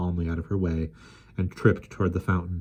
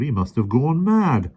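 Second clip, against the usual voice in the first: muttered; 7 semitones higher, 5 dB louder, slower than usual. A narrator's reading. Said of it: "the park keeper muttered".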